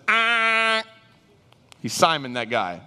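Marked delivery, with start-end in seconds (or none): 0.1-0.8 high, held buzzer "wrong answer"; buzzer noise. Acted. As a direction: sound a long rejection buzzer. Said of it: "God says".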